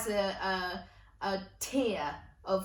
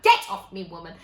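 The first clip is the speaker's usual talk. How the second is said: loud mock outburst at Nan; shouted retort; 2 semitones lower, 10 dB louder than usual. Adult self telling Nan to let go, shouted retort. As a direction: shout it as if shaking her off. Grown-up retort to nan's grip.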